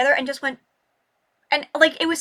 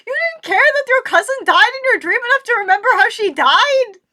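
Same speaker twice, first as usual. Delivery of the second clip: mock wail; 10 semitones higher, 7 dB louder than usual.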